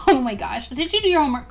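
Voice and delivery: ordinary delivery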